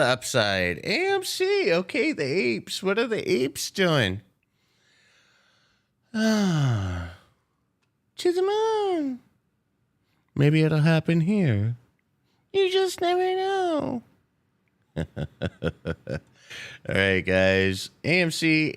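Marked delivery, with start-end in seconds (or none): 0.8-9.2 very high mock hype. High hyped meme voice. 12.5-14.0 very high teasing voice; high silly voice. Pretend. High put-on voice.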